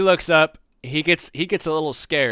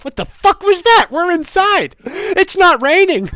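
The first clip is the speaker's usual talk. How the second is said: much higher and louder, shocked shouting; startled yell; 12 dB louder than usual. Acted. Barkeep startled by thunder inside, shouting in shock. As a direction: yell in total alarm at a clap of thunder indoors.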